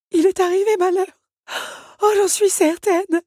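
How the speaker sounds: sobbing teapot, acted. A narrator's reading. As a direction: wail it as if in tears. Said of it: "sanglota-t-elle".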